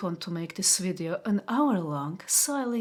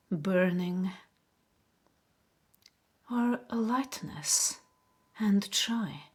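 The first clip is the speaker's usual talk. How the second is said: guided meditation. Acted. Host guiding viewers to notice body sensations, guided meditation. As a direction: guide them gently inward, unhurried, leaving space after each phrase. Leads a relaxation exercise.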